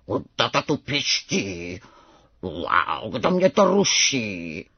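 low, slow rumbling tiger cub; low drawn-out roar of a young tiger; strange tiger roar. A narrator's reading. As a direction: growl it slowly, like father tiger. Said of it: "zařval divné jako tatínek".

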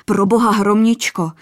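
put-on Orlík shielding the girl, blurting in terror; blurted in fright. A narrator's reading. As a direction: cry her name in sudden fear. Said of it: "vyhrkl zděšeně".

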